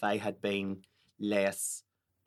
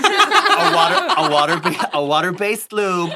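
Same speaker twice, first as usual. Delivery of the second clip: high, loud, laughing through words; 12 semitones higher, 12 dB louder than usual. Real, not acted. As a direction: crack up while talking. Laughing fit after lube joke.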